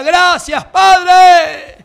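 ordinary talk

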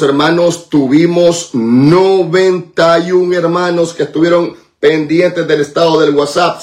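ordinary talk, nothing marked

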